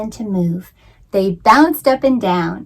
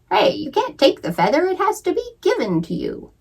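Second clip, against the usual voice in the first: higher, eager quail protest; mildly excited bird jumping in; 10 semitones higher than usual. Acted. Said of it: "Sydney became very excited".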